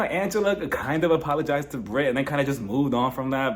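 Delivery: usual speaking voice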